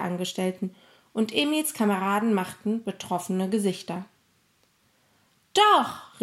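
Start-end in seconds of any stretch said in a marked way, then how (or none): 5.5-6.2 high, loud, emphatic objection; calling out. A narrator's reading. Emil contradicting the bank staff, crying out. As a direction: burst out with a sharp contradiction. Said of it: "rief Emil".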